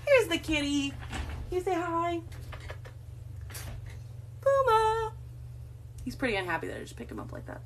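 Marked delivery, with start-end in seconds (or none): 0.1-2.2 high cooing for the kitty. Real, not acted. Baby talk to the cat. 4.4-5.1 high sing-song call to the cat; calling out to Puma. Real, not acted.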